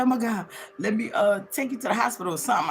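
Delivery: a touch of panic, talking fast; scared. Retold or imitated. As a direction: hurriedly offer help in a mild fright. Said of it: "I was so scared".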